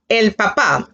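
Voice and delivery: papá with the second syllable stressed; stress on final A. Shown as a demonstration. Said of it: "important that you accent the A".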